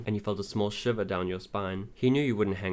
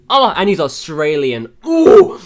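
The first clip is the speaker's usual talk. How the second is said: very high, loud fangirl squeal; swooning squeal; 7 semitones higher, 19 dB louder, slower than usual. Real, not acted. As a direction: squeal like a lovestruck fan. Swooning over his Australian accent.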